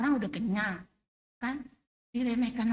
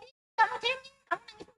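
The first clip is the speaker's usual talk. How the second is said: higher, teasing mock scold; 9 semitones higher, faster than usual. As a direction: tease her as a crybaby, pitched up high. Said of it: "tapi Tuhan nggak bilang". (ejekan mengolok dengan suara tinggi)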